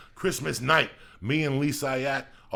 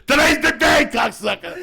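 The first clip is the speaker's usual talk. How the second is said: much higher and louder pump-up yell; full shout; 16 dB louder than usual. Pretend. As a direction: scream it like a drill sergeant. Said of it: "you tell that motherfucker".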